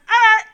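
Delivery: very loud high "Er" buzz; blinker noise. Retold or imitated. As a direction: blast it like a buzzer. Imitating the blinker sound.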